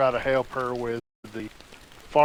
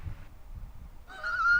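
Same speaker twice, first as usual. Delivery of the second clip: a burst of high delighted laughter; 6 dB quieter than usual. Real, not acted. Father hearing his daughter scored ninety-nine, high delighted laugh.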